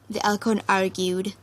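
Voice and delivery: ordinary delivery, nothing marked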